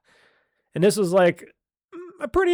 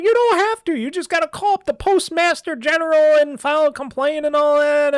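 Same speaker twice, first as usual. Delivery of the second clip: mimicking the lady; 8 semitones higher than usual. Lady's advice mimicked.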